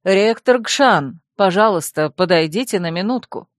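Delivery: plain reading voice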